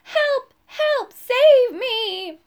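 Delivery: put-on high, loud, frantic old lady's cries; old lady crying for help. A narrator's reading. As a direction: cry out like a terrified old woman. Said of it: "an old lady's voice crying".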